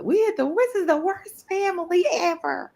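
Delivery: high-pitched mock kid whine; put-on high child's complaint; complaining kids. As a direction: mimic children griping about their family. Mimicking her complaining children.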